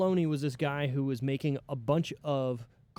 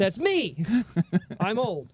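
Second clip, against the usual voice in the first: high, loud wounded protest; raised, exasperated self-mockery; comic indignation; 7 semitones higher, 6 dB louder, slower than usual. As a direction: cry out in mock offence at being old. Comic protest at feeling old.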